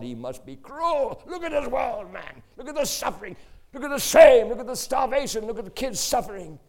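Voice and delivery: high, very loud impassioned outburst; anguished outraged shouting. Retold or imitated. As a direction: hurl each example out in acted outrage. Boss's outburst against God, retold.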